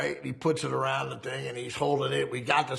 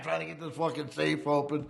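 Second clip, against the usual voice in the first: ordinary talk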